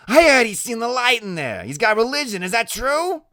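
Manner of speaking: very high, loud nosy voice; pry for gossip in Loretta's voice. Acted. Loretta questioning the boy.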